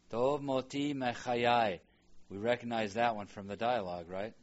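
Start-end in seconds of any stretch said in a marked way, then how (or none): 0.1-1.8 slow model reading. Shown as a demonstration. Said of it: "reading Hebrew slowly for students".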